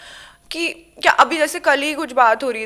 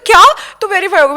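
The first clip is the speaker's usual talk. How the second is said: high, loud retold gasp of surprise; stunned disbelief; 5 semitones higher, 9 dB louder than usual. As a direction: blurt it out in shock. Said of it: "Surendra ने कहा".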